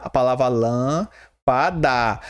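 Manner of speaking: "lã" set apart, then "lâmpada" said whole; syllable by syllable. Shown as a demonstration. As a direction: sound out "lã", pause, then "lâmpada". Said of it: "É aqui, ó, lã".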